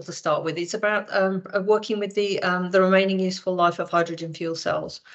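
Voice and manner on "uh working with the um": ordinary talk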